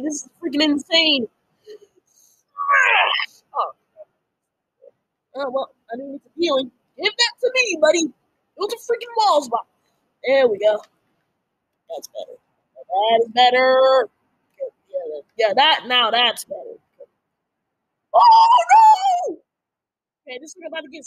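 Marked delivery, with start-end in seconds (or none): 1.6-3.4 hammer shout. Real, not acted. Battle cry swinging hammer. 18.1-19.4 very high loud shriek; panicked scream. Real, not acted. Sudden danger in game.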